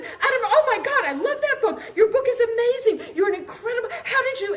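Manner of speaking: high, laughing, starstruck gushing; breathless fan fawning over the author she admires. Retold or imitated.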